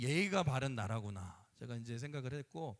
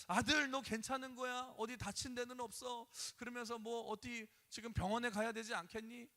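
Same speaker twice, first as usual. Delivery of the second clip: worried mother; 6 semitones higher than usual. Retold or imitated. Worried mother checking son.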